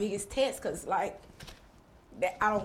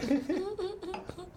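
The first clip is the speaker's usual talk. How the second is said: high laugh; 13 semitones higher, 4 dB quieter than usual. Real, not acted. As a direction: burst of high-pitched laughter. Guest laughing at joke.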